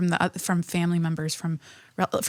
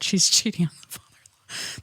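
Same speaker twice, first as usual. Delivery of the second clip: whispering; 2 semitones lower, 4 dB quieter than usual. Real, not acted. Whispered gossip punchline aside.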